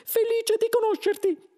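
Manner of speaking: very high, loud squeak; mouse voice. A narrator's reading. Second mouse greets Arthur, very high mouse voice. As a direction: pipe up like an eager little mouse. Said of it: "L'altro topo disse".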